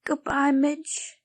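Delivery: slow, sorrowful goodbye; a child parting with her toy; sad farewell. Acted.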